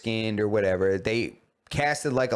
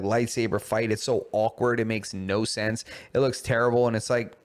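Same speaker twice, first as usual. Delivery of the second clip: ordinary delivery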